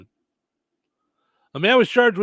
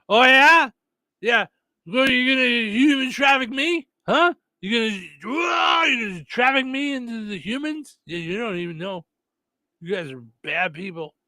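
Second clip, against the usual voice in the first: put-on drunken taunting; 4 semitones higher than usual. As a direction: taunt them like a bar brawler. Quoted taunting of the neighbors.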